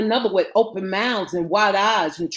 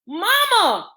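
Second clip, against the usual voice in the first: high, frightened cry; screamed call; 12 semitones higher, 4 dB louder than usual. A narrator's reading. Girl calling for her mama in the crowd, screaming. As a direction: cry out high for your mother. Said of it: "Pandora screamed".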